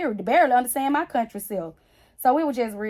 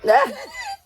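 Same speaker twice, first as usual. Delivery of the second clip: big high laugh; 6 dB louder than usual. Real, not acted. Mother laughs at clip.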